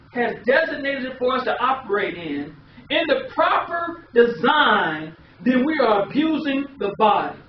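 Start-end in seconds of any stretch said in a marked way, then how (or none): none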